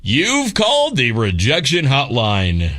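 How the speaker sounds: slow booming announcer read; big announcer voice. Retold or imitated. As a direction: announce it like a game show host. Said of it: "like, announcer voice going".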